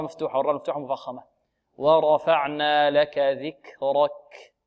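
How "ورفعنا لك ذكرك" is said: ورفعنا لك ذكرك very slow, airy ك; recitation with breathy kaf. Shown as a demonstration. Teacher reciting for the class, slow aspirated kaf. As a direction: stretch it out, releasing breath on ك in ذكرك. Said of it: "مع همس الكاف".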